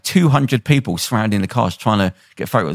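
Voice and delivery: ordinary delivery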